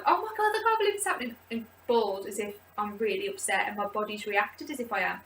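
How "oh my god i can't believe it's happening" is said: much higher, excited disbelief; overjoyed squeal. Retold or imitated. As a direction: squeal it like happy news. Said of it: "I've cried while being like".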